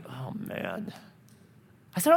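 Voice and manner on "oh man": very soft, sinking groan of words; quiet mock dismay; reluctant dread. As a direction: say it under your breath, resigned. Acted reluctant giving in.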